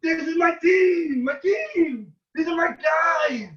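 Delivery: high, loud shouting of faith; rally the room, refuse to doubt; defiant fan cry. Retold or imitated. Young fan to friend's father, shouting belief in his team. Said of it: "And I said".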